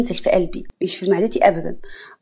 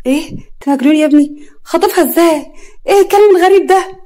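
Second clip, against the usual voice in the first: rising shocked cry, much higher and louder; shocked outcry; 8 semitones higher, 7 dB louder than usual. Acted. Mother shrieks at the young man, shocked outcry. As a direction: shriek in disbelief at each line.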